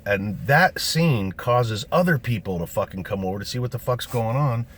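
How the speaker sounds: ordinary delivery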